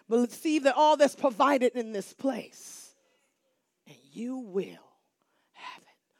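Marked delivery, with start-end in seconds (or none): 3.9-6.2 preacher whispering the promise to the church; hushed whisper. Real, not acted. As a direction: fade down to a whisper.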